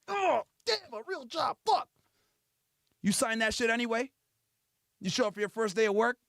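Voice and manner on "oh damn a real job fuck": high pained exclamation; hammed-up discomfort; mock agitation. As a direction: groan as if itching. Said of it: "You itching and shit".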